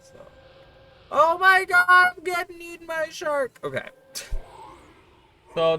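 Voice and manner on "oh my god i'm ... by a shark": high, loud hammed-up scream of alarm; mock panic. Streamer pretending the shark hat eats her, mock screaming. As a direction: shriek it like a shark has you.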